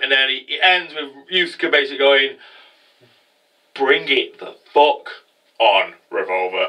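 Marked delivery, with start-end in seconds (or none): 3.7-6.7 slow, dropping into a low dare; mock heroic challenge. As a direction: act out a hero's slow, deep challenge. Acting hero's closing challenge.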